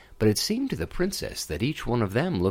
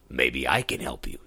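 frog voice; 9 semitones lower than usual. Acted. Frog character voice.

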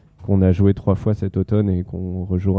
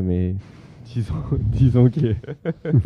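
laughing; 3 semitones higher, slower than usual. Real, not acted. Laughing over a bandmate joke.

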